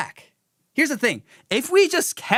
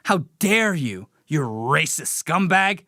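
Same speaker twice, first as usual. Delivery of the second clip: slow indignant how dare you; mock outrage; 7 semitones lower, slower than usual. Mocking a streamer scolding chatters, outraged. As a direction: sneer it like a scold. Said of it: "chastising people".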